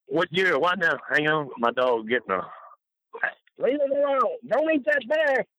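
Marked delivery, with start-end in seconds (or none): 3.6-5.5 high, sharp call to the dog. Real, not acted. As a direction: scold the dog from across the room. Scolding his dog right now.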